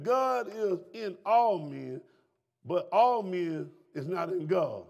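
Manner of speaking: very slow, each word set apart; slow, weighty quote. Acted. Preacher reading the verse to the church, very slow. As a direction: let the verse land slowly. Preacher quoting scripture slowly.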